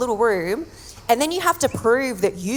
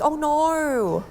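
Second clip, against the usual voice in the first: mock dismay; 5 semitones higher than usual. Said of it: "I read that and I'm like".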